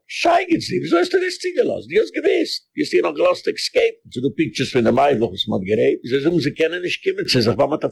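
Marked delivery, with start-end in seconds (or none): 0.1-4.0 mocking high reproach. Speaker mimics a confrontation in high-pitched scolding. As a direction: voice jumps far higher, scolding. Confronting someone over the escape.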